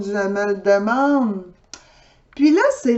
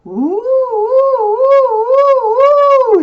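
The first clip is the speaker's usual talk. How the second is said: siren imitation; 14 semitones higher, 6 dB louder than usual. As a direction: kid imitating a police siren. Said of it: "la sirène".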